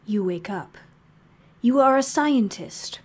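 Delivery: ordinary delivery, nothing marked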